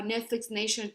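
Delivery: ordinary talk, nothing marked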